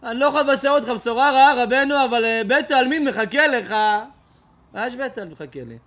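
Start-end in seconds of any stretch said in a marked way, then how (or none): 0.0-3.7 loud, high, pleading tone; loud reluctant bad news; urgent announcement. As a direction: break the news loudly and urgently. Students break bad news to rabbi.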